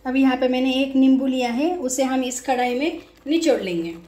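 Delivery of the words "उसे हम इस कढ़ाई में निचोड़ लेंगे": ordinary talk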